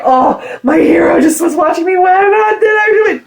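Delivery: high, loud mock yell; mortified shout. Fan shouting about her hero watching, high loud mock yell. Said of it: "I was like".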